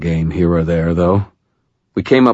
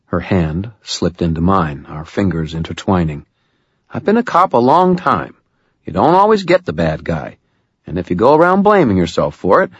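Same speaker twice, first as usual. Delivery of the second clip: Murphy, consoling; 2 semitones higher than usual. Acted. Murphy comforting Harry after a failed search, firm and kind. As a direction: reassure him like a veteran cop.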